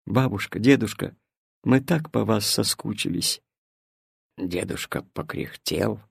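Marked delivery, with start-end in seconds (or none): 0.0-3.4 soft, lowered voice; quiet, subdued yearning; wistful longing. A narrator's reading. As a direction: tell them tenderly how much you missed them. Sad boy tells grandparents he missed them.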